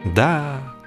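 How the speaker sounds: ordinary delivery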